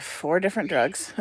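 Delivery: normal talking voice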